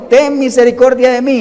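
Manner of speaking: loud, high plea for mercy; pleading cry. Retold or imitated. Blind beggar crying out to Jesus, loud pleading. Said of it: "comenzó a pedir misericordia".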